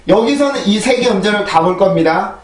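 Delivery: ordinary talk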